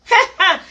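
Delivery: loud high burst of laughter; hearty laugh. Real, not acted. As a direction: let a big laugh out. Laughing at son's remark.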